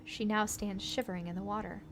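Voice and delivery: ordinary talk, nothing marked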